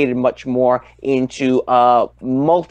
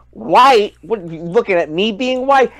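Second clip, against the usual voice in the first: mock indignant; 6 semitones higher than usual. Retorting to the loudmouth on the train, mock indignation. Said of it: "I'm like".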